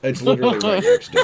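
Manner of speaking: high, loud, fast through laughter; laughing answer. Real, not acted. Game master telling player the bar is next door, laughing. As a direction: blurt it out while cracking up.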